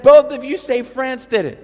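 can you believe this; father repeating the kids' story in disbelief; incredulous. Retold or imitated. Father repeats absurd claim.

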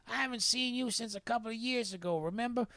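high-pitched imitated old man; put-on voice of an older Southern stranger; Bay. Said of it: "He goes".

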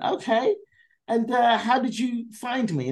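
high, slow, overly bright polite question; feigned interest. Retold or imitated. Comic pretending interest to the producers, high and slow. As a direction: put on fake enthusiasm. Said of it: "I just pretended to be interested".

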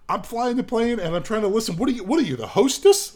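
high indignant squawk rising to a yell; incredulous outburst. Retold or imitated. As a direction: bark it out in total disbelief. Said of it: "and he's like".

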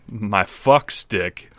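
very slow, spaced-out delivery; slow dazed drawl. Retold or imitated. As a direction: stretch it out like you're zoned out. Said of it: "He's like".

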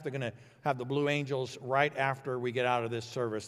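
ordinary talk, nothing marked

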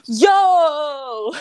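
big imitated monkey shout, high and drawn out; amazed monkey. Stunned monkey in a card-trick video, amazed shout. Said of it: "the monkey's like".

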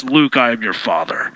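very low, booming movie dad; deep rumbling voice of a father; James Earl Jones voice. Retold or imitated. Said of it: "James Earl Jones being like the voice of dads".

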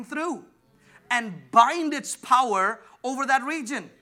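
slow, rising emphatic preaching. Acted. Preacher correcting how the church prays. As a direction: press each phrase on the crowd.

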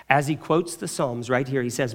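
ordinary delivery

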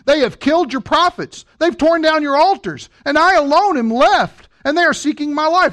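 a hint of Elijah's lament to God; pleading Elijah. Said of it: "How he pleads with God against Israel".

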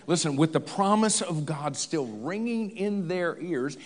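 ordinary delivery

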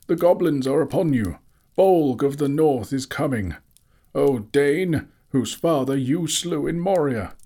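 high, loud, urgent proclamation; thunderous warning. A narrator's reading. Wizard warning the armies of goblins, thunderous. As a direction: call out a dire warning. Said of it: "he called in a voice like thunder".

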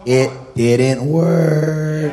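slow declaration to a defeated enemy; long-held final word; drawn-out taunting declaration. Real, not acted. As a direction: stretch it out slowly, triumphantly. Preacher's message to the devil.

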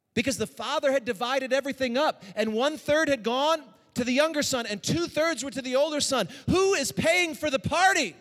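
loud, high preacher voice; rousing preaching. Acted. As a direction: drive the point home to the crowd. Preaches loudly.

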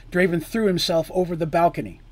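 plain reading voice

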